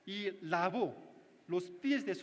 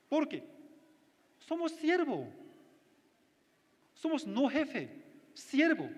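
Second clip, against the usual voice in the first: fervent preaching; 3 semitones higher than usual. Acted. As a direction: drive the point home to the flock. Rising call-and-response preaching.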